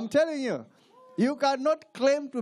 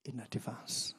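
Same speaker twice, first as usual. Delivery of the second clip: hushed teaching; 8 semitones lower, 7 dB quieter than usual. Real, not acted. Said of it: "quiet teaching passage".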